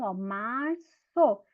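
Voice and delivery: "março" said very slowly, its s sound stretched; drawn-out março. Shown as a demonstration. Teacher agreeing with pupils, sounding out "março" slowly. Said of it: "ó, pelo som".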